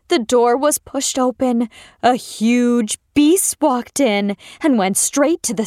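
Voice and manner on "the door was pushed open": usual speaking voice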